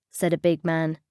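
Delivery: ordinary delivery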